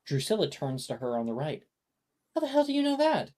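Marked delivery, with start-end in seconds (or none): 2.3-3.3 much higher, incredulous schoolgirl; disbelieving squeak. Acted. Drusilla snaps at Janice.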